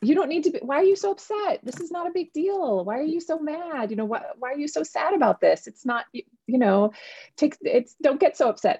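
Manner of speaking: high-pitched, mock dismissive parent; a parent's hammed-up voice waving away a child's upset feelings; exasperated parent. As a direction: send your voice up, brushing off the child's fuss. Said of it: "rescue my kids from their feelings and say".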